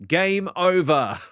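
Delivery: loud, high, slow proclamation; game-over announcement. Acted. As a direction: boom out the defeat theatrically.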